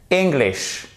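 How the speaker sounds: English with stressed "ing" start, falling; correct English. Shown as a demonstration. Teacher modelling it for learners, correct English. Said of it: "Et pas English ou English".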